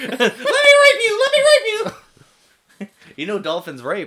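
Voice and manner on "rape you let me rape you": extremely high, loud squealed words; dolphin impression. Contestant doing a dolphin, very high loud squeal.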